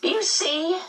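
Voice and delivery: high bright cry of joy; cried out. A narrator's reading. The medium cries out to the children, delighted. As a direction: call it out, delighted. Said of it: "the medium cried".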